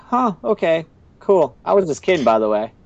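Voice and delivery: high, airy mock casualness; breezy backpedal. Imitating Illumi after the opponent surrenders, breezily casual.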